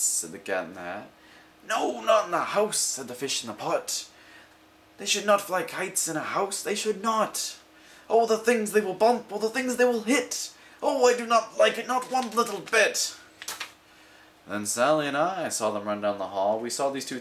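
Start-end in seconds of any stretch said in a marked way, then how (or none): 1.7-13.1 high, loud fretting fish; a fish wailing in dismay; alarmed fish. Acted. The fish protesting kites in the house, high and loud.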